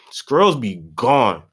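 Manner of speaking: ordinary talk, nothing marked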